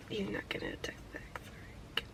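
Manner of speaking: barely voiced, very soft whisper; hushed whisper, almost no voice; whispered aside. Real, not acted. Muttered aside about her date.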